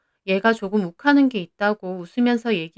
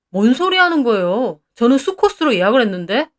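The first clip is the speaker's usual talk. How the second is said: acted irritation, a little raised; irritated retort; 2 semitones higher, 4 dB louder than usual. A narrator's reading. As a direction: challenge the manager with a frown. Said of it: "영우 씨는 미간을 확 찌푸리는 거예요". (짜증 섞어 따지는 말투)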